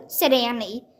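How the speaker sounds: ordinary delivery, nothing marked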